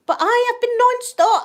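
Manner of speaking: high, loud burst; emphatic exclamation. Real, not acted. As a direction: exclaim it with the voice shooting up. Boasts about busy knitting.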